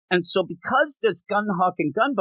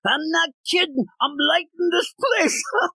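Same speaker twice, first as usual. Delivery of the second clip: over-the-top gunman; 8 semitones higher than usual. Retold or imitated. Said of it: "It's so over the top".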